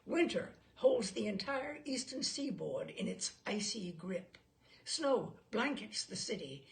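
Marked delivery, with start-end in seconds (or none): none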